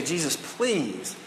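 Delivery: drawn-out, slow plea; slow pleading. Retold or imitated. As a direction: stretch the please like a humble beggar. Woman begging Jesus for help.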